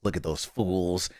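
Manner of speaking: slow, soft pretend disdain; mock smug amusement. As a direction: quietly savor looking down on the fans. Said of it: "just look at y'all and go".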